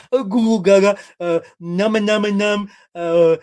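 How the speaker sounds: put-on loud baby gibberish with munching noises; hammed-up mock toddler talk; toddler babble. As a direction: babble like a baby pointing at camels. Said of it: "What she was, like, saying is".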